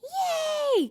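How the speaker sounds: very high, loud squeaky cheer; happy scream. Acted. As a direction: squeal with joy like a little robot. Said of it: "Gur screamed again".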